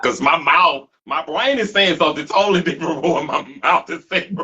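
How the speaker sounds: laughing. Real, not acted. Laughing at his own slip.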